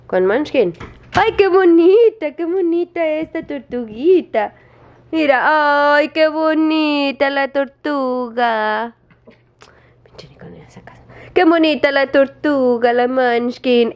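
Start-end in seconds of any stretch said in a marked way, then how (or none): 1.1-8.9 high, slow gushing with stretched vowels; exaggerated cooing. Real, not acted. Owner praises tortoise before jealous dog, cooing. 10.0-11.0 very soft, voiceless whisper; whispered aside. Real, not acted. Owner remarks on dog ignoring her, whispering. 11.3-13.9 singsong pet praise, starting high; cooing. Real, not acted. Owner praises Munchkin before jealous dog, cooing.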